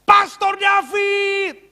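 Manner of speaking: high, loud drawn-out call; imitated far-off shout. Businessman spotting pastor across airport, drawn-out shout. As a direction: holler the name slowly across a distance. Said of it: "Tiba-tiba dia teriak".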